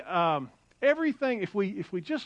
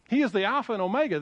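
ordinary talk

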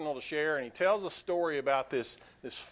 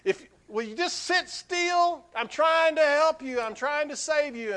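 pleading cry of a frustrated man; exasperated scream; 11 semitones higher, 6 dB louder than usual. Retold or imitated. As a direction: shout at the bird in frustration. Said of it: "he finally screams out and he says".

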